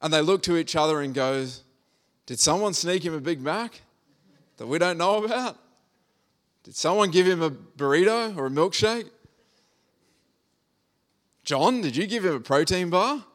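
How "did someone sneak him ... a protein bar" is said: higher, incredulous mock whispering among friends; puzzled disciples. Preacher voicing confused disciples wondering who fed Jesus. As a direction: play the baffled friends guessing at snacks.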